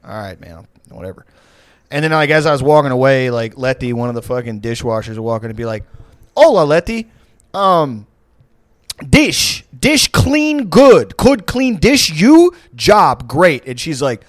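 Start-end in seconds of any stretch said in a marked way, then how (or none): 0.1-1.2 resigned mutter. Retold or imitated. Brushing off the manager. 6.4-13.7 high, very slow, over-simplified English to a dishwasher; condescending broken English. Retold or imitated. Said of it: "he would talk in this, like, weird broken English with an accent".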